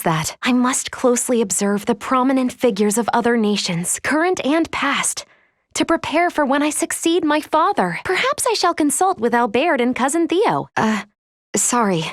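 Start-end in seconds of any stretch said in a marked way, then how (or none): 0.4-10.7 pitched-up impression. Android lifting her voice to mimic a young prince. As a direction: lift the voice into another person's register.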